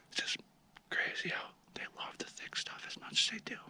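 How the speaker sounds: hushed whisper while stalking. Real, not acted. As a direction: whisper so the deer can't hear.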